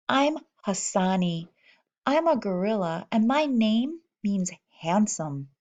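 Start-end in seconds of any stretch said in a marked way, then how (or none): none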